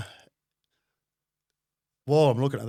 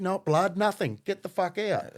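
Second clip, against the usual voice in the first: high, louder scolding; angry ordering; 7 semitones higher, 5 dB louder than usual. Retold or imitated. Car owner to bleeding passengers, angry ordering. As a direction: bark them out of the car.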